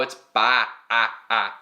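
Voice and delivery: "ba, ah, ah" with the mouth wide open. Shown as a demonstration. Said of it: "open the mouth wide".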